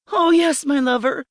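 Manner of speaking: high, passionate cry; rapturous high outcry. A narrator's reading. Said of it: "I cried out".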